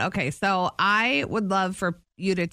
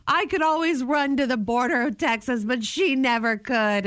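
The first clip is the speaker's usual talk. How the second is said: quoting Sally Field; 7 semitones higher than usual. Retold or imitated. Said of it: "Sally Field does that, like".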